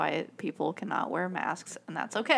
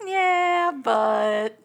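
mocking voice; 7 semitones higher, 7 dB louder than usual. Mocking Americans.

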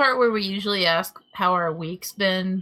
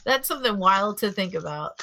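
normal talking voice

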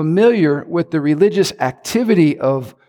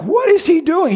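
much higher, alarmed cry of disbelief; shocked surprise; 13 semitones higher than usual. Retold or imitated. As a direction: squeal it in shock at the tackle. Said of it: "And I'm like".

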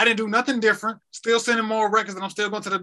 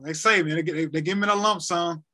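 ordinary talk

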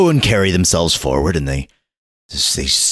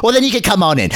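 put-on much higher, prim boy's voice; snooty little boy; faster than usual. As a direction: look down your nose like a posh little boy. Boy letting them in.

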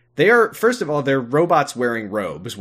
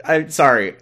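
ordinary talk

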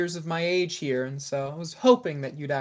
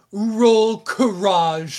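slow, louder, higher hammed-up French accent; mock French accent; 6 semitones higher, 7 dB louder, much slower than usual. Game master asks for a courage roll, mock French accent.

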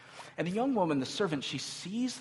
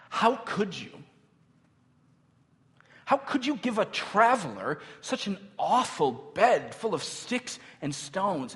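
higher-pitched, indignant reproach; acted yelling; 5 semitones higher than usual. Traveler berating servant girl over awful bed, acted yelling. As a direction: scold her, punching the key words. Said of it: "begins to yell at her".

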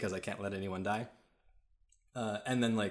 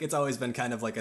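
ordinary talk, nothing marked

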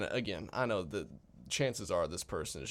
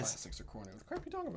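mock outrage; 10 semitones higher, 3 dB quieter, much faster than usual. Real, not acted. Mock outrage defending horror films.

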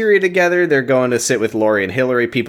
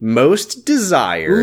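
mock award announcer; 4 semitones higher, much slower than usual.

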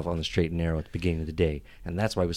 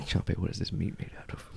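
soft, whispered interrogation; menacing questioning; 10 dB quieter than usual. Acted. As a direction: press the servant, barely above a whisper. Master interrogates servant.

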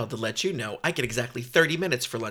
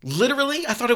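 shocked; 9 semitones higher, 5 dB louder, faster than usual. Acted. Shocked at lube in food.

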